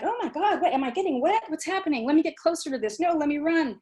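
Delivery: put-on a child squealing at a sprinkler; excited child. Lecturer playing a small child meeting a sprinkler, mildly excited. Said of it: "a child for the first time discovering a sprinkler".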